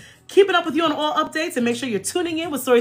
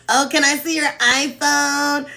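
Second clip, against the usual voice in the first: a teasing copy of his eager ask; mocking mimicry; 3 semitones higher than usual. Mocking her future husband's eager question. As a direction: mimic him like a kid sister. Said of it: "I mimicked him".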